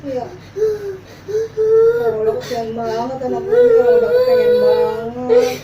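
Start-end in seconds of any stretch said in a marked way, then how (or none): 1.2-5.6 slow, loud, high wailing lament; drawn-out mock crying. Mother to son about the lost toy, slow wailing. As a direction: stretch each word into a sob. Parents prank child, feigned grief.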